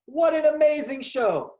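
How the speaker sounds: loud, high upbeat show host; announcer. Acted. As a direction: wrap up the show with big energy.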